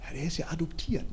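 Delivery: dropped to a hushed gossip; gossipy aside. Retold or imitated. Relatives whispering at the family meal, hushed. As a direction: lean in and spread the rumour softly.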